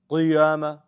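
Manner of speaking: loud, overdone thick qi in "qiyama"; super heavy qi. Shown as a demonstration. Teacher mocks a wrong reading, over-heavy qi in qiyama. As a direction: say qiyama with an exaggeratedly heavy qaf. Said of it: "We don't talk like that".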